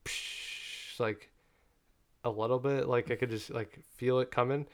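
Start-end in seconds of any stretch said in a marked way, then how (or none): 0.0-1.0 soft hissed "psh" of spraying water; a drawn-out, quiet "pssshh"; water spray noise. Retold or imitated. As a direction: imitate water starting to spurt, voiceless. Imitating water spraying from pipe.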